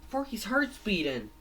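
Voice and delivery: high-pitched cartoon surprise; amazed realisation. Fangy hears Forky's heart restart, amazed realisation. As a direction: lift it high, discovering good news.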